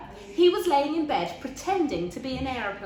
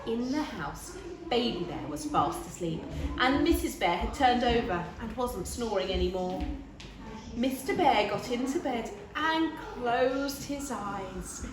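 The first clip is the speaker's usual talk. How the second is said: plain reading voice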